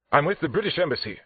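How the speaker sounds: high, loud, indignant declaration; indignant statement. A narrator's reading. Suspect pulling rank on detective, indignant. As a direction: announce it quickly as a protest. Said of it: "Schaefer then stated".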